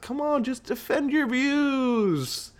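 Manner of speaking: hammed-up begging voice; mock pleading. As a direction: beg your buddy in a high, drawn-out plea. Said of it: "I was like".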